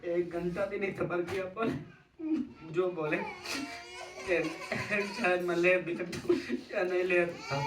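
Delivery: high, loud wailing sobs through the words; sobbing. Real, not acted. Son telling how his father died, wailing in tears. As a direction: break down completely, crying out the words. Breaks down recalling father's death.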